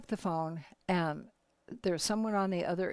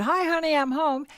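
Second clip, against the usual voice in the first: calling out; 7 semitones higher, 7 dB louder than usual. Retold or imitated. Husband calling as he comes home.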